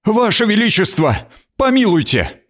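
high, frightened plea for mercy; pleading cry. Acted. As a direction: beg the queen, crying out. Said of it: "Один вскрикнул".

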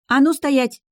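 high, loud sharp order; exasperated big-sister yell; barked command. A narrator's reading. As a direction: bark it out to stop the kids. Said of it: "рявкнула Эль".

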